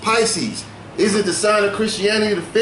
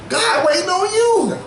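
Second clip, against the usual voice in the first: high, laughing through the words; 9 semitones higher than usual. Real, not acted. Host laughing to co-host about God waiting. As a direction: laugh it out in disbelief. Laughing while making point.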